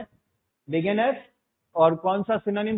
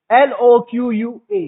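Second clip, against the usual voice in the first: slow letter-by-letter spelling, louder; spelling out slowly; 9 dB louder, much slower than usual. Shown as a demonstration. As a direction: spell each letter out slowly and clearly for the class. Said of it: "word याद रखना". (धीरे-धीरे, ज़ोर से एक-एक अक्षर बोलना)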